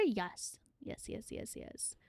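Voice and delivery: conspiratorial fading whisper. Pretend. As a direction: whisper yes, fading to nothing. Secretive agreement, fading.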